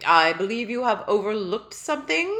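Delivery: loud, stately reproach; a royal clearing his throat to remind you; pompous tiny king. Acted. Tiny king reminding Ellie of his breakfast, loud and stately.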